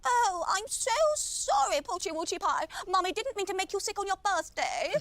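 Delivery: drawn-out, high gushing apology; doting baby talk. Acted. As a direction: coo to a poorly pet like a mummy. Owner babies her sick dog.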